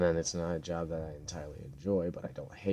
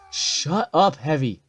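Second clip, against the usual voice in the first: real angry yell; 10 semitones higher, 15 dB louder, slower than usual. Real, not acted. At the enemy Heavy hounding his bat, a furious shout. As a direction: shout it hard and high, snapping at him.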